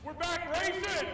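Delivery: high, loud, slow triumphant cheer; excited shout. Real, not acted. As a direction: yell it out to the crowd. Winner cheers to the fans.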